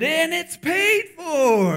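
long drawn-out jubilant holler; excited shout. Retold or imitated. Cashier announcing to a customer it's paid for, excited shout. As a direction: sing out the news, high and loud.

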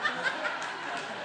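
retold laugh of the churchgoers; imitating their laugh. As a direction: puff out a quiet knowing laugh. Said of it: "And they go".

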